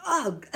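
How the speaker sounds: sputtered ugh of disgust. A narrator's reading. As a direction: spit out a revolted ugh. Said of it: "she sputtered".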